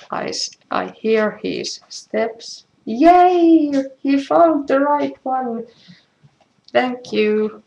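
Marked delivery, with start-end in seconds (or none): 2.8-5.7 high, loud little cheer of delight; excited cheer. Real, not acted. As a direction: celebrate the right ink arriving. Husband found the right ink.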